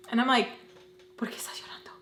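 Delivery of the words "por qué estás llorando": hushed, baffled whisper; voiceless whisper; whispered question. Retold or imitated. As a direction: whisper it, imitating a puzzled aside. Whispered question to crying woman.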